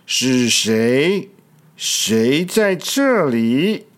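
slow, menacing growl of a blind beast; a scary T. rex demanding to know who is there; scary dinosaur voice. Acted. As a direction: loom over them with the question. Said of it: "用很可怕的声音说".

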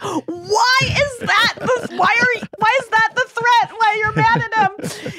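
high, squealing laugh-talk; words squeezed out through big laughter; laughing hard. Retold or imitated. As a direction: imitate dad cracking up, high-pitched and helpless. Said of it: "my dad would laugh so hard".

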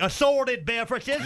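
oblivious uncle. Acted. Uncle ordering a drink heading, oblivious. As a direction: announce it with proud confidence.

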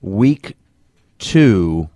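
ordinary talk

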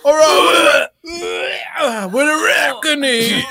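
high, strained shouted intro; rock star scream. Acted. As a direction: scream it at the crowd.

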